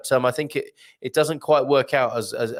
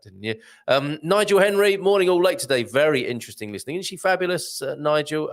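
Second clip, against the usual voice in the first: usual speaking voice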